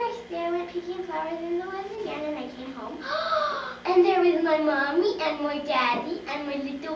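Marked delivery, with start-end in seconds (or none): 3.1-3.8 high surprised gasp. Acted. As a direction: gasp in amazement. Family back home.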